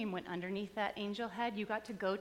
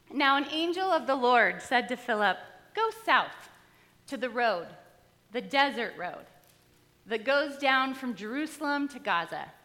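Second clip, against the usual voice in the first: louder, declaiming Bible reading; scripture reading; 2 semitones higher, 7 dB louder than usual. Acted. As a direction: proclaim the passage to the congregation. Reads the scripture passage.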